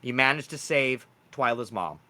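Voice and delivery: usual speaking voice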